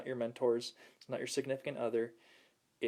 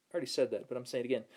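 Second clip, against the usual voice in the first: ordinary talk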